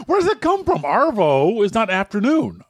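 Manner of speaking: high-pitched, laughing protest; exasperated disbelief. Real, not acted. Arguing over where Arvo comes from, exasperated disbelief. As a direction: object in baffled, playful exasperation. Mock-baffled dispute over slang word.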